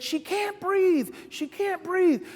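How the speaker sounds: high, slow, frightened repeat; mother pleading in panic; panicked mother. Retold or imitated. Mother telling father the child is choking, high frightened plea. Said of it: "And she said".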